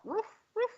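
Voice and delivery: high, quick mock dog barks. Shown as a demonstration. Woman showing off what she can do, high dog bark. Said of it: "I can bark".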